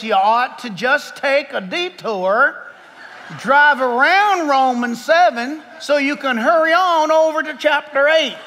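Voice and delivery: slow, drawn-out imitation of a preacher's accent; J. Vernon McGee's accent. Shown as a demonstration. Preacher reading a quote to the congregation in the author's accent. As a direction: put on the radio Bible teacher's accent, taking it slowly. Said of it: "should I read it in his accent".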